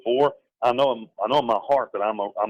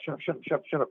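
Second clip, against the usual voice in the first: urgent hushing; 7 semitones higher, 6 dB quieter, faster than usual. Retold or imitated. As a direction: shush the client urgently under your breath. Lawyer hushing an angry client.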